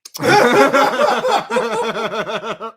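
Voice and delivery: sudden high laugh; high burst of laughter. Real, not acted. Hot wings aftermath laughter.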